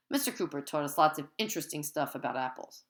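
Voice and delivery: ordinary delivery, nothing marked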